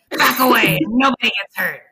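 loud, gruff threat; tough biker guinea pig. Acted. Guinea pig warns the imaginary Fluffy off his food, gruff and loud. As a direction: bark it like a grizzled biker. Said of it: "biker with a pack-a-day habit".

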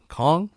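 "Kong" as in King Kong, rising; Kong with open "o". Shown as a demonstration. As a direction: try "Kong" as a questioning guess. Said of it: "Maybe that's pronounced wrong".

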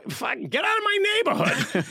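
high-pitched mock shout in Boston accent; Boston local's outburst. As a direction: chase the newcomer off, hammed-up Southie accent. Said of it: "They'd be like".

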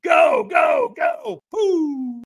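high, loud, drawn-out urging shouts; imitating a roaring firewalk coach; trainer yelling go. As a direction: send them off with a whooping yell. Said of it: "And the trainer goes".

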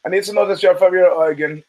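imitating a German officer, lightly accented. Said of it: "in his German accent".

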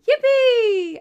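high, loud, drawn-out mock yippee; sarcastic cheer. Podcaster facing a week of shows she cannot stand, sarcastic cheer. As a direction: fake a big delighted squeal, sarcastically. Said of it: "I'm being sarcastic when I say that".

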